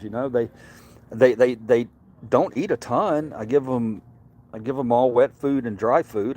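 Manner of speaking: usual speaking voice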